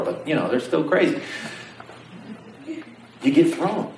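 usual speaking voice

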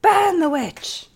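angry mob leader. Pretend. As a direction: bellow it like a frenzied villager. Echoing mob cry.

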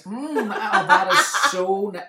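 high, loud fake relish; overdone mealtime delight; mock enthusiasm. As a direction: laughingly act out praising the dinner. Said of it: "eating a dinner going".

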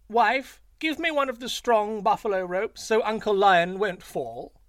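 high, loud, showy jackal call. A narrator's reading. Jackal calls loudly to his wife so Lion hears, high and showy. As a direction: make sure Lion overhears the fake order. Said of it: "aloud he added".

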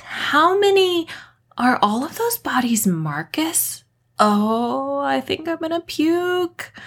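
put-on high, quivering panicked young woman; a rattled girl close to throwing up; Kat, high and shaken after the horror; shaky young woman, freaked out.

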